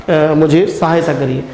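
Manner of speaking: ordinary talk, nothing marked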